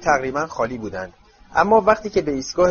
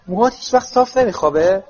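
higher-pitched mild exasperation; despairing; 6 semitones higher, 4 dB louder than usual. A narrator's reading. Mrs Weasley fussing over Harry's hair, mildly despairing. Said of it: "ناامیدانه خانم ویزلی گفت".

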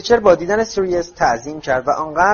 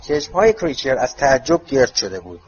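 usual speaking voice

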